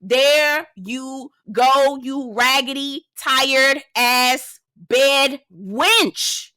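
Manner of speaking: slow, taunting drag on every word; drawn-out mock insult. Talking back to Ashley on screen, drawn-out taunt. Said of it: "I said".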